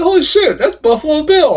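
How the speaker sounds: high-pitched mock-stunned realization; retold amazement. Movie reviewer retelling spotting a familiar villain actor, amazed.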